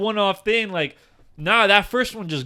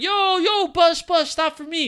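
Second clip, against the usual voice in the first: calling out to bus; 12 semitones higher than usual. Retold or imitated. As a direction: yell it at a passing bus. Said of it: "I'm like".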